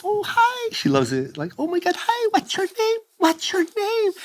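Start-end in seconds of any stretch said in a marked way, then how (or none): 0.0-0.7 high-pitched, delighted mom voice; gushing mom. Retold or imitated. Mom spots a cute little kid, high and gushing. 1.6-4.1 put-on very high, sing-song cooing at a child; gushing mom. Mom fawns over a little kid, high and gushing.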